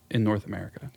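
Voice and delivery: ordinary talk, nothing marked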